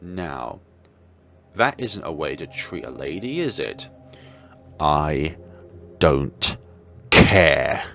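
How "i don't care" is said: loud, word by word; angry outburst. Acted. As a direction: spit each word out in rage. Outburst at the villain.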